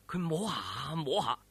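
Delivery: ordinary delivery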